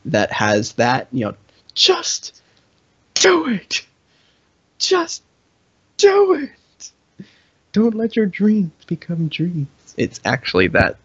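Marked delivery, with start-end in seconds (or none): 1.8-6.9 high, strained hype voice; motivational shout. Retold or imitated. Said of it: "motivation taunt". 7.7-9.7 high intense hype voice; motivational meme. Retold or imitated. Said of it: "motivation taunt".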